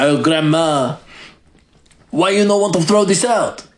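put-on a granddaughter with a faint French accent; mock French accent. Granddaughter questions grandma's clutter, light mock French accent. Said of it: "I don't know why she's French".

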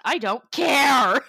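loud, slow, laughing cry of release; laughing shout. Retold or imitated. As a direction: shout it with reckless glee through a laugh. Laughing, shouting while driving lost.

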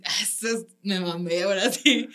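laughing triumph at your own joke. Retold or imitated. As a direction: crack up at your own line. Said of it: "te va a dar un chingo de risa".

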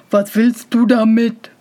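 put-on weary, disdainful older-brother voice; scornful brothers. A narrator's reading. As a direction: sigh at the fool, lightly in character.

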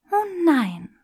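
high, loud mock alarm; mock dismay. Granger feigning alarm at Draco's weakening integrity. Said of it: "machte Granger".

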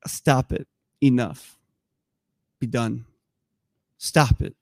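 low, spaced-out orders; firm command. Acted. Preacher telling a thief to quit, low firm command. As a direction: put a stop to it, each phrase separate.

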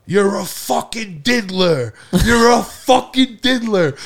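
high, drawn-out accusing yell; imitated screaming. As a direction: scream it in someone's face, stretching each word. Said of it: "screaming at him".

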